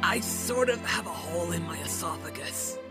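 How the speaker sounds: low, quiet, weakened voice; soft deathbed understatement; dying murmur. Acted. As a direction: explain faintly as you fade. Dying hero explains wound.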